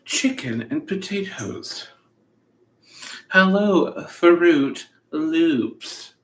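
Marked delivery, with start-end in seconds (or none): none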